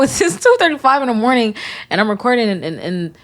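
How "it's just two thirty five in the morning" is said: laughing through the line, higher up. Real, not acted. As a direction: laugh it off at the late hour. Laughing at herself.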